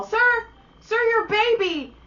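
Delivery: high, slow call to a passing dad. Retold or imitated. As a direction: sing out sir across the walkway. Calling out to a passerby.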